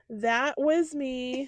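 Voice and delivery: drawn out, very slow; slow admission. Real, not acted. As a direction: stretch out "That was me" word by word. Admitting the embarrassing moment.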